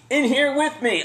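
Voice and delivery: loud, high muffled answer; yelled reply. Retold or imitated. Fugitive admitting key is with him, loud shout. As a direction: shout it back from inside.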